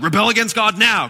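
loud, high mock villain shout; blatant tempter. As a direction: make the devil openly brazen. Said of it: "He doesn't come out like".

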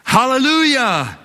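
high ringing hallelujah shout; preacher shouting praise for the church to echo. Shown as a demonstration. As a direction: call it out to lead the crowd. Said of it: "say it".

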